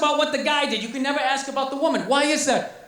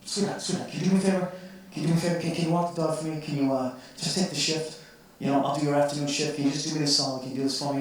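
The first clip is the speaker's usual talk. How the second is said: sweet-talking plea; 8 semitones lower, 5 dB quieter, faster than usual. Retold or imitated. Pleading with girlfriend for favor.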